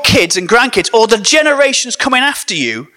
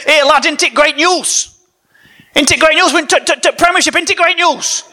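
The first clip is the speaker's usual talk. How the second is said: put-on higher, excited generic northern English accent; broad mock Huddersfield accent, pitched up; 6 semitones higher than usual. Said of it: "that is a very good Huddersfield accent".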